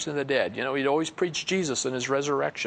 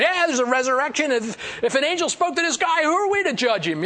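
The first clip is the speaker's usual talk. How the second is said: higher and louder, a riled-up Pharisee; hammed-up crowd shouting in the council; mock heated shout; 10 semitones higher, 4 dB louder than usual. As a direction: fire it back at the Sadducees. Pharisees shouting back in a riot.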